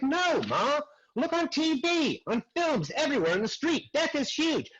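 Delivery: high-pitched protest to Ma; acted-out exasperation, pitched well up; exasperated retort. Arguing with mom about the acting class, exasperated. Retelling an exasperated reply to mother.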